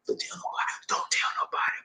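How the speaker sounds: hushed, furtive whisper; secretive whisper. Acted. Macho man begging secrecy about crying, whispering.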